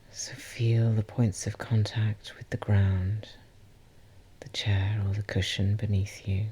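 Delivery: guided meditation. Acted. Host guiding listeners to call back their energy, guided meditation. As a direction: ease listeners gently inward, leaving space between phrases.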